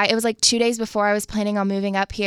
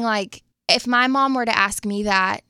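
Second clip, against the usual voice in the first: ordinary delivery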